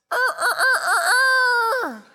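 high, drawn-out rooster crowing. Shown as a demonstration. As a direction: crow like a rooster at dawn, stretched out long and high. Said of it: "My best impression of a rooster".

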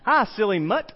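cheery mock toddler hail; imitating a three-year-old. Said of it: "called me a silly mutt".

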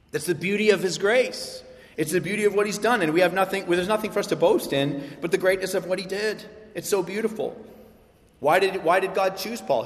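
ordinary delivery

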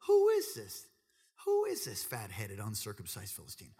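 astonished, voice jumping up; can't believe it. Acted. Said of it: "It's like he can't believe it".

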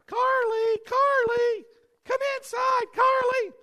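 high, sing-song calling of the dog; imitating wife's gentle call; sweet high calling. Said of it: "my wife has trouble getting our little dog in".

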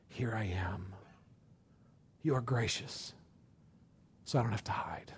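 soft whispered confession to God; hushed confession. Retold or imitated. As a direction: let it fall to a whisper of relief. Confessing openly before God.

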